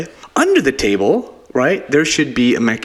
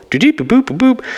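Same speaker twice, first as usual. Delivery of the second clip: beeping sound; 11 semitones higher, faster than usual. Pretend. Imitating scanner beeps.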